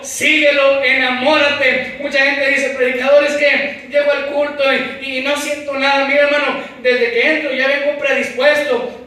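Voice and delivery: loud, fervent preaching, often slowed; full-force preaching. Acted. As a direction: preach it out with fire.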